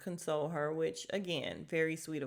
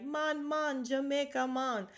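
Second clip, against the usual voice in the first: higher-pitched, lightly put-on Jamaican accent; mock Jamaican accent; 6 semitones higher than usual. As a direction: Marlo hamming a Jamaican greeting. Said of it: "Marlo comes and she's like".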